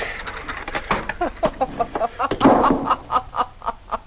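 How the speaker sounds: long falling breathy laugh; cruel laughter. Acted. Kitty laughing at the old man who proposed, long cruel laugh. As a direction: laugh it all out at him.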